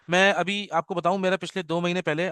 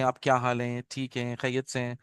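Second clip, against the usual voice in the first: low, soft, courteous inquiry; polite educated speaker; 6 semitones lower, 6 dB quieter than usual. Acted. Example of educated people asking after someone, politely. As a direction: imitate refined speech, pitched down and quieter. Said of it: "جو اچھے لوگ بولتے ہیں".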